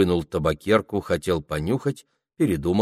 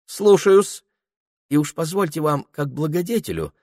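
fawning peasant voice; 9 semitones higher than usual. Acted. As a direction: play it as a wheedling coachman. Ilya answers obsequiously.

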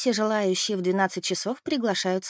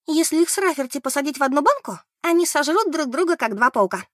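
high, loud gleeful announcement; 7 semitones higher, 4 dB louder than usual. Acted. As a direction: announce it with glee. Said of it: "радостно объявила Бет". (радостно и громко)

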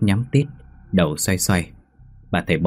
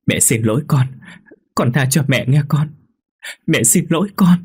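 rising tearful plea; 7 semitones higher than usual. Acted. Mother pleading rising.

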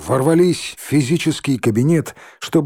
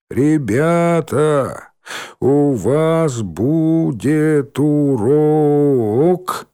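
slow, high, syllable-by-syllable reading; 4 semitones higher, much slower than usual. Acted. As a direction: spell it out slowly like poor readers. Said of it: "читали они в два голоса".

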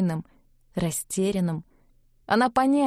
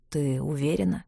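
low husky question; 5 semitones lower than usual. A narrator's reading. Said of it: "спросил он хрипло".

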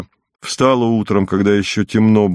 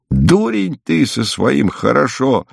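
higher, drawn-out scolding, mildly angry; cross cry; 5 semitones higher, slower than usual. A narrator's reading. Exasperated woman at the guest who only says «хорошо», cross cry. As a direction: scold slowly with pitch raised, not volume. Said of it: "с сердцем крикнула баба".

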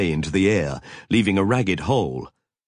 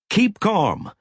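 sharp, higher command; snapped; 12 semitones higher than usual. A narrator's reading. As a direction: snap it out to calm the room. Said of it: "snapped Nathan Boss".

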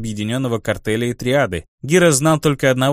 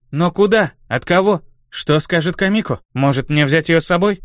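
put-on worried young man, raised pitch; anxious, higher questioning; 5 semitones higher than usual. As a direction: panicky questions. Tamoshi panics, asking.

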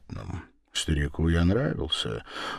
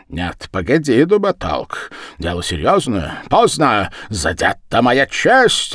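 heated, raised-voice objection; mild growl, loud and high; 5 semitones higher, 6 dB louder than usual. A narrator's reading. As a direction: protest angrily over wounded honour. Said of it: "рычал Ворона".

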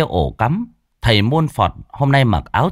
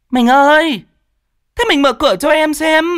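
loud, very high woman's holler; high-pitched yelling; 4 dB louder than usual. A narrator's reading. Rival woman calling her darling to open up, yelling. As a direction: holler high, stretching the call. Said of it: "cũng chống nạnh gào lên".

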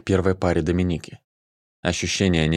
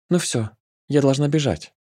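put-on tender girl voice; 7 semitones higher than usual. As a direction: coo it, pitched high. Nika, high girl voice.

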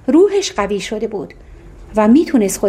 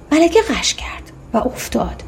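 hushed narration; 4 dB quieter than usual. A narrator's reading. Queen faints, narrator hushes.

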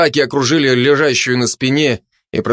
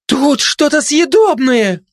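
very high, slow cry; excited shout; 14 semitones higher, slower than usual. A narrator's reading. Gossip finds food by the fence, crying out. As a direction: call it out high, stretching the words. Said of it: "вдруг крикнула обжоре сплетница".

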